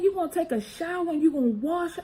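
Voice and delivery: mom ordering. Retold or imitated. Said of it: "She said".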